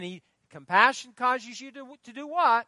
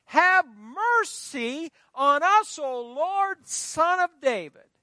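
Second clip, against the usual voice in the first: drawn-out pleading call, raised up; crying out; 6 semitones higher, 6 dB louder, much slower than usual. Retold or imitated. Preacher voicing blind men crying to Jesus, slow raised plea. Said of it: "cried out".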